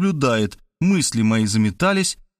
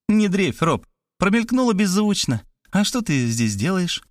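put-on higher, breezy girl's voice; cheeky Varvara; 7 semitones higher than usual. A narrator's reading. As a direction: brush off his worry cheekily. Varvara brushes him off.

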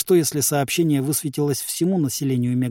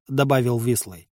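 ordinary delivery, nothing marked

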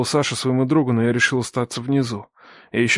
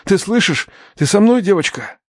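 higher-pitched, worried questioning; anxious urgency; 6 semitones higher than usual. Acted. Man shakes the wounded girl lightly, anxious and urgent. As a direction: press her anxiously for a response.

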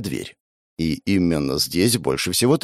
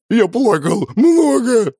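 blubbering monster; 13 semitones higher, 5 dB louder, slower than usual. Acted. Cow boss weeping.

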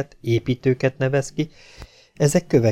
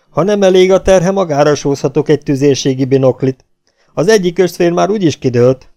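loud, bullying drill-corporal yelling; corporal's bellowing; 3 semitones higher, 7 dB louder than usual. A narrator's reading. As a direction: berate a straggling soldier at full volume. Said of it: "és ráordított".

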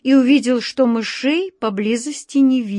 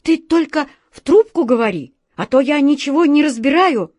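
higher, louder, nervous hurry; nervous rush; 7 semitones higher, 5 dB louder than usual. A narrator's reading. The director nervously rushing his words to Alyosha. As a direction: talk fast, glancing over your shoulder. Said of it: "нервно оглядываясь, быстро заговорил".

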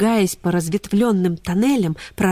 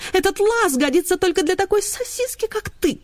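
haughty greyhound; 12 semitones higher than usual. Acted. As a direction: look down on the sausage dog. Rosa continues her lament.

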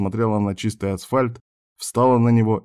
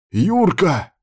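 high cry of the name; raised, urgent shout; alarmed call; 8 semitones higher, 4 dB louder than usual. A narrator's reading. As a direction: call out in alarm to someone not moving. Calls to slumped driver.

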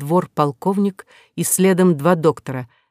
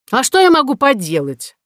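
higher, louder cry on я; exasperated shout; 7 semitones higher, 4 dB louder than usual. A narrator's reading. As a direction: shout it, rallying to attack. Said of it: "вскочил и закричал".

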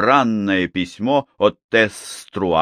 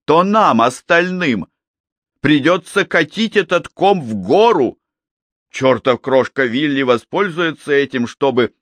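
loud, high, and cut off before the end; heated outburst, cut off; 5 semitones higher, 4 dB louder than usual. Acted. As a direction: build it to a shout and stop dead. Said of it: "Он замолчал".

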